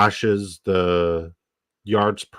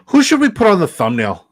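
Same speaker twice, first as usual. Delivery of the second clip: excited; 11 semitones higher, 7 dB louder than usual. Real, not acted. Hyped question to chat.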